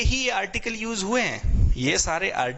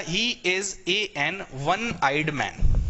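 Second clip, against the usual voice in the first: drawn-out, word by word; slow model reading; much slower than usual. Shown as a demonstration. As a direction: spell the sentence out slowly. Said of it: "अब जैसे बोला".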